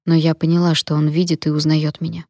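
usual speaking voice

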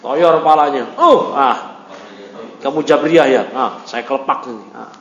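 ordinary delivery

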